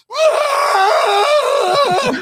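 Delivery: very high, long, loud mock scream; imitated night-terror scream. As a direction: let out a sustained piercing shriek. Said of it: "all of a sudden you'd hear".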